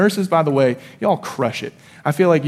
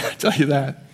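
ordinary talk, nothing marked

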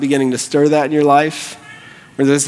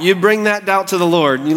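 ordinary talk, nothing marked

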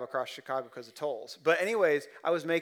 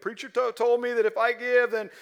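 higher, louder mock congregant; preacher mocking a listener who misheard, high and loud; mocking misled listener; 8 semitones higher, 10 dB louder than usual. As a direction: ham up a greedy listener's voice. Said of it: "don't walk away and be like".

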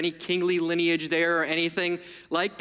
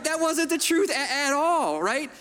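usual speaking voice

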